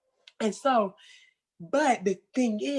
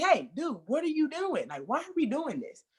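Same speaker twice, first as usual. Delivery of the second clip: high, friendly mock yell, not harsh; playful yell; 7 semitones higher, 4 dB quieter than usual. Coach calling out a misbehaving kid, a playful high yell. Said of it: "not like this harsh, like, yell".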